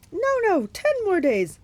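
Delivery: loud, high falsetto pleading; high put-on voice, protesting; Grover. A narrator's reading. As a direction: haggle in a panicky bridal voice.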